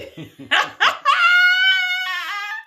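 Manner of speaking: high shrieking burst of laughter; loud laugh. Real, not acted. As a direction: cackle out loud at the joke.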